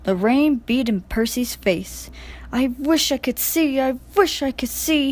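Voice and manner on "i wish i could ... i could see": higher, whiny complaint; complaining. A narrator's reading. Percy half-blinded by rain, complaining plaintively. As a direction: moan it twice, fed up. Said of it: "he complained".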